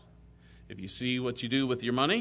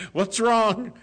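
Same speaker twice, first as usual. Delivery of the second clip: much higher and louder, startled concern; a worried, startled what's wrong; alarmed question; 11 semitones higher, 9 dB louder than usual. Retold or imitated. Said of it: "you're crying".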